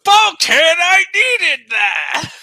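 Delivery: very high, loud anguished cry; dramatic shout. Acted. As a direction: scream it with huge drama. Repeats line with full drama.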